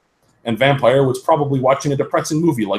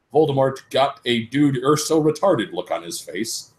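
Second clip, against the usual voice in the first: ordinary delivery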